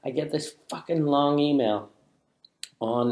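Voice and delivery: ordinary talk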